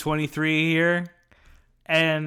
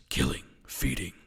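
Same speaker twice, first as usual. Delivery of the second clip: whispered; 8 semitones lower, 6 dB quieter than usual. Pretend. Dramatic intro read.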